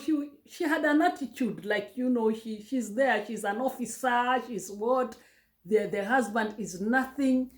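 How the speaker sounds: normal talking voice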